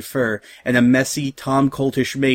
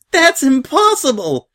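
very high, slow incredulous shout; loud, high-voiced soldier refusing to believe it; shouting in disbelief; 14 semitones higher, 5 dB louder than usual. A narrator's reading. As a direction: cry out in stretched-out disbelief. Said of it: "shouted another".

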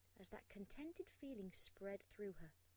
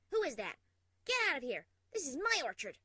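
angry shout; 4 semitones higher, 13 dB louder than usual. Acted. Yelling at an intruder.

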